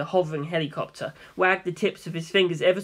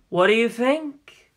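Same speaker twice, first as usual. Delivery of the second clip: playful taunt; 5 semitones higher, slower than usual. Acted. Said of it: "Funny asked".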